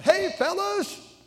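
high, loud, drawn-out happy greeting; cheery call. Retold or imitated. Tony cheerily stops two boys on a bike, loud and high. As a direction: hail the boys like a happy friend. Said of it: "he was a happy guy".

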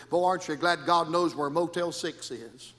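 ordinary delivery

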